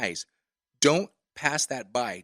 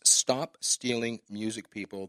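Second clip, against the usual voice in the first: slow slogan; 3 semitones lower, slower than usual. Shown as a demonstration. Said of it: "three favorite words I use".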